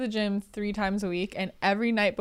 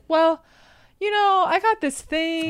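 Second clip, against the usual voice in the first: high, louder imitation of an excuse; dead-serious excuse-maker; 8 semitones higher, 6 dB louder, slower than usual. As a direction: mimic a partner reasoning out of it, pitched up. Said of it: "dead serious. He's like".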